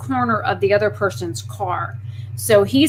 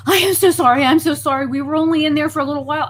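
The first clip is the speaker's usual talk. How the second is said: apologetic second woman; 6 semitones higher, 4 dB louder, much faster than usual. Retold or imitated.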